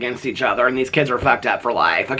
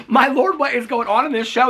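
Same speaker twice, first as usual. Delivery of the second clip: much higher, incredulous; mock disbelief; 9 semitones higher than usual. Reacting to the TV family, she asks in much higher disbelief. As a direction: gasp it out in disbelief. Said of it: "I'm like".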